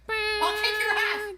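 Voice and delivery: high, slow, slurred threat; belligerent drunk. Acted. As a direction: drawl it like a sloppy drunk. Repeated clip line.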